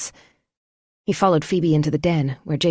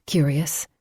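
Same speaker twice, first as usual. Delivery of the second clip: ordinary delivery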